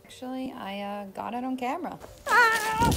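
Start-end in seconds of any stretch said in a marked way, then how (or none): 2.3-2.9 sudden high yelp of alarm; startled scream. Real, not acted. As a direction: yelp as you slip and fall. Falling on camera.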